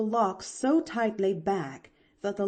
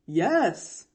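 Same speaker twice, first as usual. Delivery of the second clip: lightly voiced doctor, a little louder; slightly grave smile; 5 dB louder than usual. A narrator's reading. Doctor answering the girl upstairs, a little gravely. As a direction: answer kindly but a touch gravely. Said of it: "smiled the doctor a little gravely".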